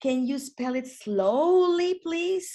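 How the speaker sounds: slow, patient request. Shown as a demonstration. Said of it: "And you can also say".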